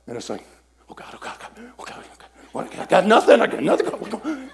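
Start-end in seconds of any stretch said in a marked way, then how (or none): 0.9-2.3 breathless whisper of "Oh, God" over and over; hushed, desperate plea to God; whispered frantic prayer. Retold or imitated. Frantic silent prayer in seminar. 2.5-4.5 higher and louder, half-laughing panic; comic panic at having nothing to say; panicked. Retold or imitated. Blank during prophecy exercise.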